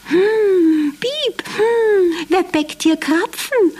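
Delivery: high mouse voice, sniffing curiously; sniffing the air. Acted. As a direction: sniff around like a nosy mouse. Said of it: "hob schnuppernd sein Näschen".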